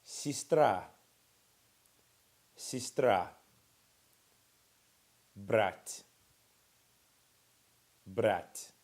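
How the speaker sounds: sistra and brat said loud and clearly, each twice; teacher models sistra and brat for repeating; model pronunciation. Shown as a demonstration. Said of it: "The next line down".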